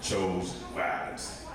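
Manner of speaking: deep calm documentary narration; Morgan Freeman impression. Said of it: "that's my Morgan Freeman impression".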